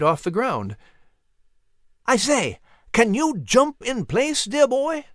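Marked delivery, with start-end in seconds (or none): 2.0-5.1 high, swooping call without extra volume; mild calling shout. A narrator's reading. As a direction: call up to someone on a branch. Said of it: "Nigel shouted".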